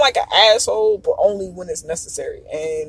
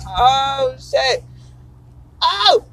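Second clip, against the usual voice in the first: surprised; 4 semitones higher, 3 dB louder, much slower than usual. Retold or imitated. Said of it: "I'm just like".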